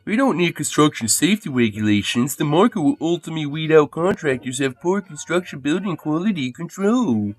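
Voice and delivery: lightly voiced smug commentator lecturing; a hint of a pundit talking down about markets; Dave Rubin impression. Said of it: "Dave Rubin".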